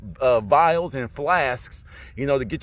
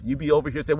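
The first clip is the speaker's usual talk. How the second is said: usual speaking voice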